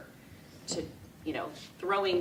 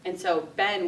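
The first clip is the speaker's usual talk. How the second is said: ordinary talk, nothing marked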